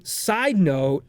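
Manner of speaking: ordinary delivery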